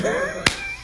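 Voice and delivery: very high wheezing laughter; real laugh. Real, not acted. Speaker joking about marriage after fifty, laughing high. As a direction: laugh high after your own joke.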